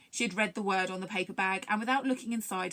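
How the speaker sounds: ordinary delivery, nothing marked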